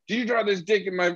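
high-pitched mock questioning; retold accusation. Blaming people over the wallet drawing, mock accusing.

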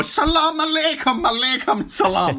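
greeting said in a mock Irish accent. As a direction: imitate an Irish woman greeting in Arabic. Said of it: "hear her with that accent go".

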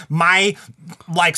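ordinary talk

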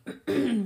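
quick rough throat clear. Real, not acted. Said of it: "pardon me".

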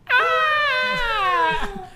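high, held mock scream; high-pitched imitation film scream; imitated Wilhelm scream. Shown as a demonstration. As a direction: scream as a house-mix sample. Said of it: "that should be on replay".

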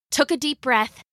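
ordinary delivery, nothing marked

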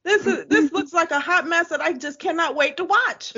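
high-pitched laughing delight; amused, laughing as you talk. Real, not acted. Laughing at a messy show.

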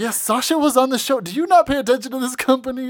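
high, loud exasperated retort; exasperated nerd. Retold or imitated. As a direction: scold like a fed-up nerd. Nerds retorting to Regal.

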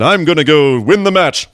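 lightly voiced campy strongman; a hint of a campy stoic wrestler; campy athlete. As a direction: puff up a little as a hammy hero. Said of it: "playing it almost a little bit campy".

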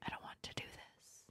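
whispered plea. Real, not acted. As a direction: breathe it, no voice. Uncomfortable refusal, whispered.

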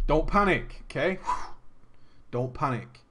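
steady everyone with a long breath; acted-out forced calm; not panicking. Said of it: "we all decided not to panic".

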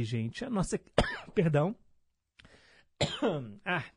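coughing fit mid-sentence; choked up, coughing; choking. Real, not acted. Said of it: "Dei aquela engasgada aqui".

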